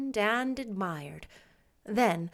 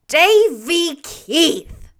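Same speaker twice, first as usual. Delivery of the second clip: put-on slow, loud, drawn-out call of the boy's name; stern scolding; 7 semitones higher, 12 dB louder, much slower than usual. A narrator's reading. Marilla scolds Davy for climbing, stern and loud. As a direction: scold the naughty boy by name. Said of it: "shaking him by the shoulder".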